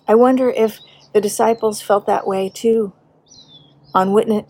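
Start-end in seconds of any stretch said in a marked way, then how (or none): none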